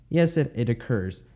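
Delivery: ordinary talk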